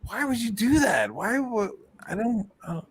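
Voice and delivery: high, fading shocked questions; shocked disbelief. Retold or imitated. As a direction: recoil in disbelief. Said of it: "I was like".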